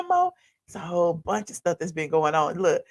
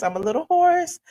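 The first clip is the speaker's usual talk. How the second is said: higher squeaky retake; 8 semitones higher than usual. Shown as a demonstration.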